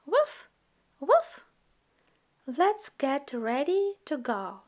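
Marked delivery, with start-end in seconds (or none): 0.1-1.5 high little puppy woof; puppy bark. Acted. Voicing the puppy Biscuit barking.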